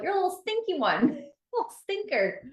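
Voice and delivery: high, giggly pet name; playful teasing. Real, not acted. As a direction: tease her fondly with a laugh. Affectionate teasing nickname.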